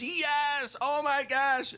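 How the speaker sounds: squealed high and delighted; an imitated, excited, high squeal of yes. Stripper confirms she was on the show, squealing high.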